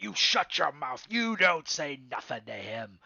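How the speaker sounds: angry thug. Acted. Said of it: "is like".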